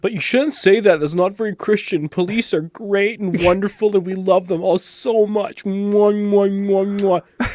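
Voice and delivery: mock-pious, high and loud; sarcastic. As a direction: gush sarcastically over the police.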